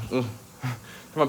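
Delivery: normal talking voice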